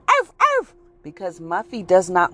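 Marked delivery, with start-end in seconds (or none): 0.1-0.7 two short, high-pitched arfs; dog bark imitation. Muffy the dog answering her owner, barking. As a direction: yap twice like a little dog. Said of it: "what kind of barking tone is that?".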